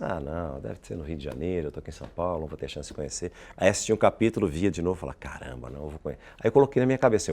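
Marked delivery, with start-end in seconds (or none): 0.0-3.3 softly talking himself out of it; retold inner thought. Retelling his resigned inner thought. 5.2-6.2 very soft, lower-pitched thought; retold private resolve. Retelling his private resolve.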